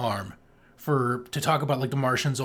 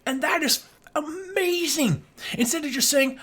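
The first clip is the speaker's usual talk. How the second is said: much higher, gushing with amazement; excited; 12 semitones higher than usual. Real, not acted. Host praising the script to his co-host, excited. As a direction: let the delight burst out. Gushing about film's physics.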